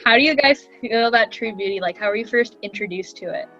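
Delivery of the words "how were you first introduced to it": ordinary talk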